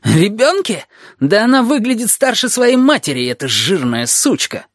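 astonished outrage. A narrator's reading. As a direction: start amazed, end on a relished insult. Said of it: "Изумлённая душенька едва не выронила". (изумление, переходящее в злость)

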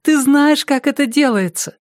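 put-on high, insistent little girl's voice; whispered urging. A narrator's reading. Girl cheering on her pitcher Tom, high urgent pleading. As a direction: coax him along in a high girlish pitch. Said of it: "прошептала она".

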